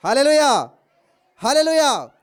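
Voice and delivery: shouted, ringing hallelujahs; full-voiced preacher's shout; loud hallelujah. Real, not acted. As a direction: call it out loud for the congregation. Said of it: "గట్టిగా హల్లెలూయా చెప్పండి".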